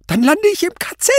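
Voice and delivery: very high, loud, terrified screech; shriek. A narrator's reading. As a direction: screech it in pure terror. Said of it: "kreischte Kluge". (panisches Kreischen)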